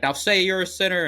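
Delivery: high, loud preacher's order, imitated; mock preacher's demand. Preacher forces Daniel to confess before church, high mock demand. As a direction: push it up high like a bullying preacher.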